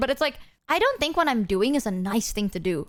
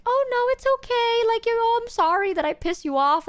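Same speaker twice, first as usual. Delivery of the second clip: meek apology; 11 semitones higher than usual. Retold or imitated. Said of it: "I'm just like".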